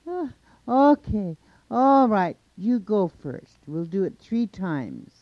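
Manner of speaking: loud, high, drawn-out agreement; big hollered acceptance; calling back. A narrator's reading. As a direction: holler the terms up, starting very high. Monster calls back, accepts challenge.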